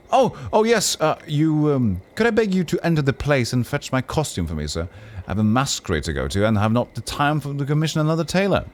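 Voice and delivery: high, eager pleading courtier voice; fussy noble. Acted. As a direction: rush up and beg for attention, pitched high.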